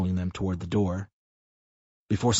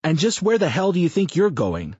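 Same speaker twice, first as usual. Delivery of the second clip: louder, higher, demanding question; gruff challenge; 9 semitones higher, 5 dB louder than usual. A narrator's reading. Sam challenges the departing man, gruff loud demand. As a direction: call him out.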